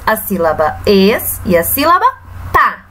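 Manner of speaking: ordinary talk, nothing marked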